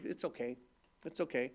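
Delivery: very soft, low, forgiving; gentle reassurance. Retold or imitated. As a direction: soothe quietly as a forgiving wife. Wife forgives his apology, retold.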